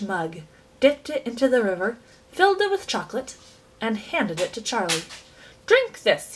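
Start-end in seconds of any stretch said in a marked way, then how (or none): 5.7-6.3 much higher, brisk offer; eccentric host. A narrator's reading. Chocolate maker offering a starving boy a drink, brightly. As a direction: push the mug at him cheerfully. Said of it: "he said".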